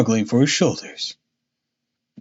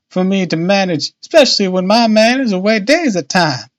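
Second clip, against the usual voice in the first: high, lively dialect woman's voice; chatty boatman's wife; 10 semitones higher, 6 dB louder than usual. A narrator's reading. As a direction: prattle on, high and proud. Narrator voices Missus Smith.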